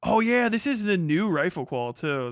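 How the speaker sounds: ordinary talk, nothing marked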